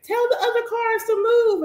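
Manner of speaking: put-on high child voice asking; imitating son. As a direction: play the little son demanding. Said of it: "My son be like".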